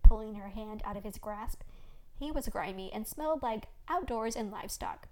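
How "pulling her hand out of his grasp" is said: ordinary delivery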